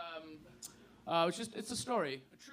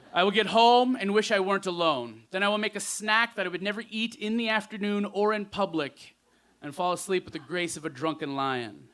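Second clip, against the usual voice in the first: louder poetry recital easing down at the close; spoken-word poem; 2 semitones higher, 13 dB louder than usual. Acted. Poet tells the audience of getting home as a drunken lion, spoken-word recital. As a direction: recite it rhythmically, then let it settle.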